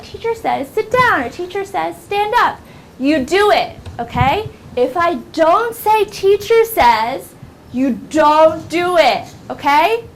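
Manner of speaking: put-on high, emphatic teacher explaining to kids; instructing classroom teacher. Shown as a demonstration. Teacher explaining the game rules, high and emphatic.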